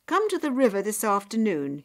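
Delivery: loud, hearty instruction with a hint of a father; lightly acted father giving orders; lightly voiced father. A narrator's reading. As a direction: call her along, a touch commanding. Father telling daughter to come along.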